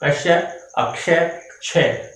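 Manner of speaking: कश्य, अक्षय, क्षय run through one by one; कश्य, अक्षय, क्षय as spoken variants; variant pronunciations. Shown as a demonstration. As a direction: list the three ways people say it. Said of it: "जो अलग-अलग उच्चारण है".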